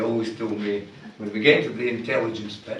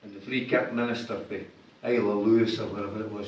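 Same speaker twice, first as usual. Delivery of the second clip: ordinary delivery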